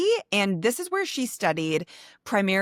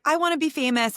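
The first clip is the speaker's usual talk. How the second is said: mock child voice; 7 semitones higher, faster than usual. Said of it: "she was like".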